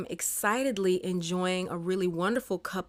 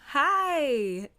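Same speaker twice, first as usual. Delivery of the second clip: joyful hi; 8 semitones higher, 4 dB louder than usual. Real, not acted. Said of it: "You just hear a lot of pure joy in my voice".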